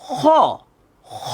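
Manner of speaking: "খ" as a raspy back-throat fricative; letter drill. Shown as a demonstration. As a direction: scrape the breath on "খ". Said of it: "বলেন".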